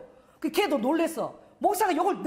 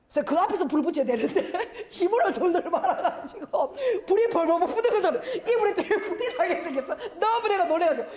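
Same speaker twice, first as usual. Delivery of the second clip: high, laughing panic; laughing while telling; 5 semitones higher than usual. Real, not acted. Pastor to congregation, laughing at the fire scare. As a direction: laughs through the near-fire story.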